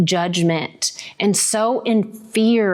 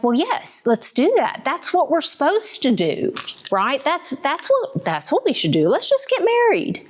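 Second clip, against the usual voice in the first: higher, rising eager yes; eager agreeing; 8 semitones higher than usual. Retold or imitated. Young woman to boyfriend about marrying, eager and higher. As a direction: talk yourself into it brightly.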